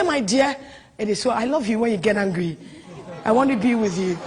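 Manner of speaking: low, soft, soothing voice; acted tender comforting, pitched well down; tender reassurance. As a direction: soothe an upset partner gently and quietly. Voicing a soothing partner to angry woman.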